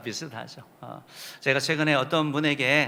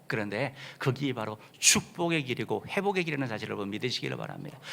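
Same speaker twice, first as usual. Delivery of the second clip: normal talking voice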